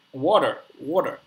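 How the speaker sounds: "water" said the American way with a sounded final r; American accent. Shown as a demonstration. Showing his friend how Americans say it, American accent on "water". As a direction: say "water" in American, the r pronounced at the end. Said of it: "in the American accent".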